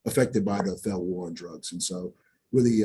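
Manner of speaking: ordinary talk, nothing marked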